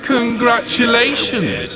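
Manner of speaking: high sarcastic cheer; mock congratulation. Demon mocking Michael with sarcastic cheer. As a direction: sneer the praise. Villain mocks hero.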